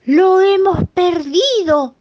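high, slow, crying wail; wailing alarm. Acted. The piglet brothers crying out that they lost him. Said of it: "dijeron los dos a coro".

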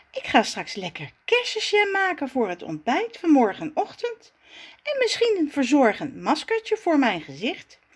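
put-on high, chatty girlish voice, pleased with her plan; Zaza's voice. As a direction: play the vain little Zaza dreaming of beauty. Zaza planning her jam. (hoge, tevreden stem van Zaza)

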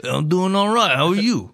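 a touch of gangster Italian; light mock gangster Italian. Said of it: "that went gangster Italian a little bit".

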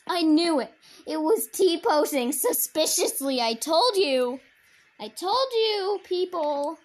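triumphant shout. Real, not acted. Gloating shout at players.

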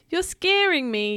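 high-pitched mild yelp; high storybook fright. A narrator's reading. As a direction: act a scared little protest. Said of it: "yelped Peso".

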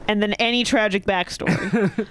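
ordinary talk, nothing marked

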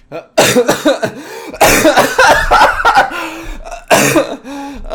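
loud high choking fit; real choking cough. Real, not acted. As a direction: cough hard on spit. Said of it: "I choked on my spit".

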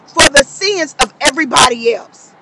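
high, charged sermon delivery building to a cry; impassioned preaching. Acted. Preacher moved to tears preaching the sacrifice, fervent. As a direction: preach it with full conviction, voice climbing.

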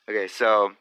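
normal talking voice

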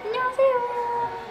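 cutesy greeting. Real, not acted. High cutesy greeting to viewers.

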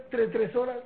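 ordinary talk, nothing marked

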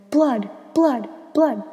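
high, loud repeated cries; yelling. A narrator's reading. Narrator shrieks on seeing blood on the jacket, panicked yell. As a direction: shriek it in panic, each cry falling.

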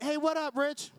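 casual dismissive greeting. Retold or imitated. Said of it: "you're like".

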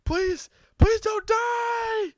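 very high, slow, drawn-out plea; hammed-up scream. Yamcha begs Goku not to die, a high drawn-out scream. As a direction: stretch every please into a wail. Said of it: "Yamcha screams".

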